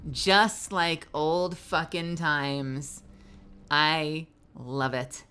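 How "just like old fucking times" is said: very slow, louder drawn-out; mocking quote. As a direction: relish the swear. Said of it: "he says".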